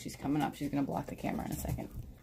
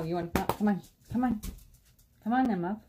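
calling a pet; 4 semitones higher than usual. Real, not acted.

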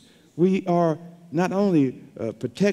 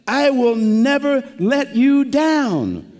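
higher, slow, "never" weighted; emphatic promise; 6 semitones higher, 3 dB louder, slower than usual. Shown as a demonstration. Preacher delivering God's promise to the congregation, rising and emphatic. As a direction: bring it home slowly on "I will never let you down". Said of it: "to bring home the positive".

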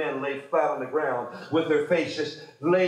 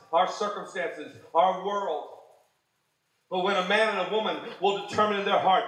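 normal talking voice

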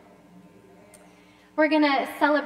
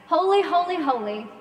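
leading call; 4 semitones higher, 6 dB louder than usual. Shown as a demonstration. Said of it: "repeat after me".

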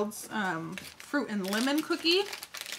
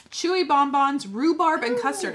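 excited announcement; 7 semitones higher, 8 dB louder, slower than usual. Real, not acted. Announcing a new treat.

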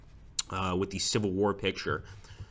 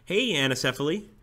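usual speaking voice

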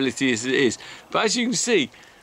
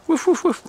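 mock barking; 14 semitones higher than usual. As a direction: bark on the dog's behalf for fun. Barking for the dog again.

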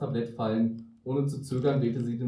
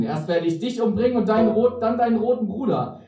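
loud, high villainous threat; menacing; 8 semitones higher, 7 dB louder than usual. A narrator's reading. Witch threatens to kill Luigi and Mario, menacing.

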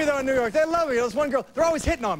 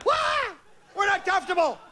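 drill orders; 8 semitones higher than usual. Retold or imitated. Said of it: "I put 'em all in the same row".

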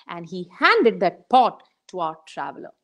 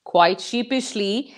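plain reading voice

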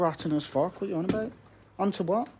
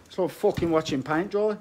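ordinary delivery

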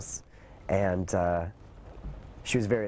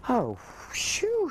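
relieved sigh. Retold or imitated. Mother relieved, wipes forehead.

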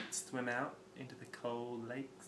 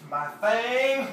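mock exclaim; 9 semitones higher, 11 dB louder than usual. Jokey exclaim in twang.

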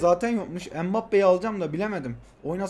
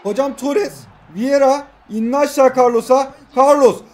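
loud, high, rapid calling of the passes; excited play-by-play; 4 semitones higher, 6 dB louder than usual. Real, not acted. Gamer calling his own attack aloud, loud and excited. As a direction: call each pass as the attack builds.

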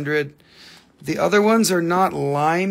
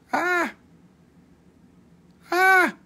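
high sharp squeal, louder on the second; sudden shriek; 3 dB louder than usual. Pretend. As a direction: startle them with a high yelp. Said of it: "I'm just gonna mess your head up".

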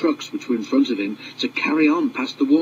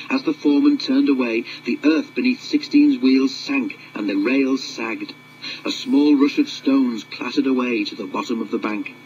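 plain reading voice

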